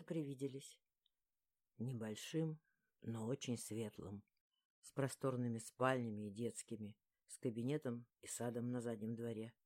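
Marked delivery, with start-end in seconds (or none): 1.8-9.5 low, hushed man's murmur; whispering male lead. A narrator's reading. Lexer describes their future house to her, hushed low whisper. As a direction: murmur it low and soft, like sharing a dream. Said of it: "прошептал Лексер".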